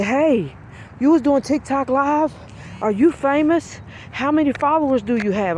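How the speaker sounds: put-on high, eager kids' questions; excited children. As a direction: pile on the questions like a thrilled child. Children rush up asking excitedly.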